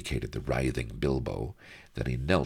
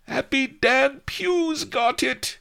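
very high, loud squeal; panicky babble; 6 dB louder, slower than usual. A narrator's reading. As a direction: blurt the secret in a tiny falsetto. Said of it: "babbled Goliath".

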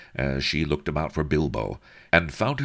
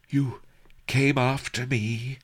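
higher, amazed question; trembling wonder; 11 semitones higher than usual. Acted. Sheba marvels at the masked stranger, higher voice. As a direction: let the wonder show. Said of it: "Her voice trembled with wonder".

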